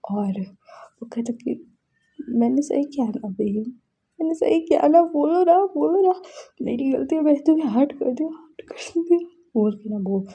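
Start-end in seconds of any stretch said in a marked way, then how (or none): 2.3-9.2 higher, imitated sobbing plea; retold guilt-ridden begging, pitch lifted; tearful pleading. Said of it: "बोल के रो रहा था". (रोते हुए माफ़ी माँगना, हल्का अभिनय)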